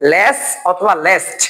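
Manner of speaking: লেস অথবা least said slowly, each word stretched; slow and drawn out. Shown as a demonstration. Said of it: "এগুলো আরো একটা নিয়মে করা যায়". (লেস অথবা লেস্ট টেনে টেনে ধীরে বলা)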